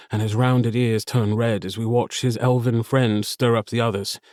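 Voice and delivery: plain reading voice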